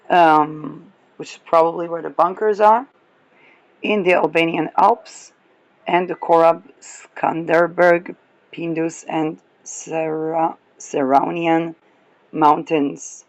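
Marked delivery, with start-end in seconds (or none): none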